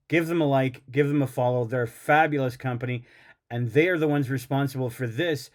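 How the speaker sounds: normal talking voice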